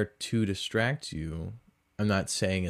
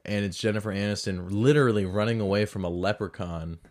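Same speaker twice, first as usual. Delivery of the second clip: ordinary delivery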